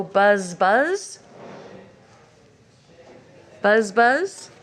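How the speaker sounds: buzzing, rising and loud; bee buzz. Shown as a demonstration. Teacher inviting children to buzz along. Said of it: "He can go like a bee".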